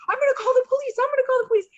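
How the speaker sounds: high, loud panicked threat; freaking out. Retold or imitated. Clerk to the arguing guests, high panicked threat. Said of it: "the guy's freaking out".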